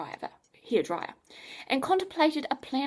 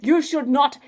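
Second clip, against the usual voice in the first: a touch of wounded pride; offended; 3 semitones higher, 9 dB louder than usual. Acted. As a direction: scientist miffed that Adam stole his line, mock offended. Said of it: "in a very offended voice".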